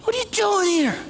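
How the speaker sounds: high, incredulous question; lightly voiced scornful king; scoffing king. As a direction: sneer at the scrawny boy. King scoffing at scrawny kid.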